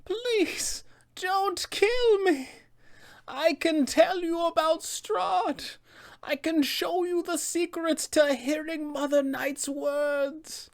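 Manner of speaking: captured witch pleading, very high and slow; Baba Lysaga. Acted. Captured witch begs players for her life, high pleading cry. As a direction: beg in a soft, high, drawn-out witch voice. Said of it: "she will cry out in a terrible manner".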